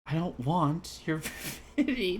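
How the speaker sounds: slow line cracking into a laugh; breaking into laughter. Acted. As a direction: try it and crack up. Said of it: "let me try and deliver this line".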